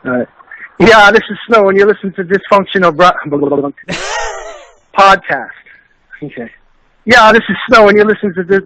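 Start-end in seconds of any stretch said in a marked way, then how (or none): 0.8-5.5 higher-pitched radio drop read; promo bumper. Acted. Guest recording a bumper for the podcast, promo read. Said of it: "can you do a bumper". 7.1-8.6 higher-pitched radio drop read; promo bumper. Acted. Guest recording a bumper for the podcast, promo read. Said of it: "can you do a bumper".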